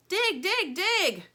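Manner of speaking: high, loud urgent chant; crying out. Acted. Plastic urges Stingray to dig, loud. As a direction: urge her on, insistent. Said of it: "cries Plastic".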